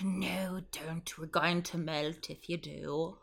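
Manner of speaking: hushed, voiceless pleading; mock whisper. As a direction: whisper it like a scared platform. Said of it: "Instagram's like".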